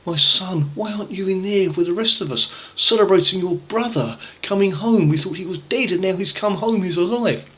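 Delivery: coaxing his son to come in; pleading father. Acted.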